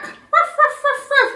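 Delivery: high playful mock barking with a laugh; dog bark. Shown as a demonstration. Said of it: "Ducks like to bark".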